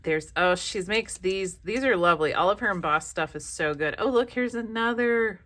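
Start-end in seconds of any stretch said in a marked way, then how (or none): none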